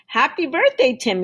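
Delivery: high, loud cheerful call across the street. Acted. Can man greets Tim from down the street, loud high call. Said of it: "he called".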